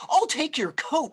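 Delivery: granny offering. A narrator's reading. Grandmother taking her guest's coat, eager. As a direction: offer to take the coat warmly. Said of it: "Bubba Bryna".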